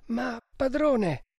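high, drawn-out plea; dismayed servant's appeal; pleading protest. Acted. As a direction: protest to the master, slowly and pitched high. Servant protests master's risky order.

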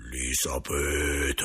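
low, slow, drawn-out spirit voice; deep spectral moan with long vowels; grave ghost. A narrator's reading. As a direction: intone it gravely from beyond the tomb. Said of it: "risposi con voce grave".

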